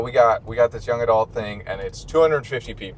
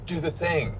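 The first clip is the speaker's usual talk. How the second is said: higher-pitched eager request, lightly imitated; excited fan request; 6 semitones higher, 4 dB quieter than usual. Campus fans run up to him, lightly imitated eager request. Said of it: "they'd run up to me and be like".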